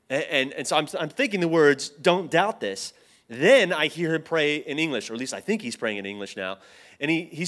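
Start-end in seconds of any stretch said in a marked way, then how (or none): 1.9-2.9 "doubt this" said slowly and deliberately; a slow, firm order to himself; deliberate self-instruction. Retold or imitated. Retold firm resolve to himself.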